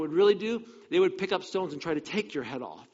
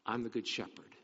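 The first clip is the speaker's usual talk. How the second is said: softer, lower and calm; gentle declaration; 5 semitones lower, 9 dB quieter than usual. Retold or imitated. Preacher voices Jesus telling the crowd, quiet and lower. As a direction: say it quietly and simply.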